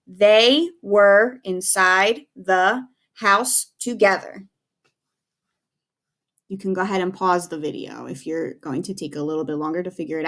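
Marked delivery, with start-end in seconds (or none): 0.1-4.5 very slow, every word spaced out for writing; slow dictation. Shown as a demonstration. Teacher dictating a sentence to students, said very slowly. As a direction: dictate it slowly so kids can write along. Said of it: "give you about two minutes to write the sentence".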